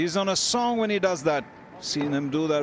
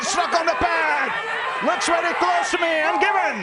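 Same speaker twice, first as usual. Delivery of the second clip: high, loud wicket call; excited shout; 12 semitones higher, 4 dB louder than usual. Real, not acted. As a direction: burst out as the finger goes up. Wicket appeal given, commentator erupts.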